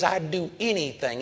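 ordinary talk

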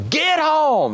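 high, sharp shouted order; stomping old woman shouts at her dog; yelled command. Retold or imitated. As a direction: bark it at the dog like you mean it. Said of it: "yelled at her and says".

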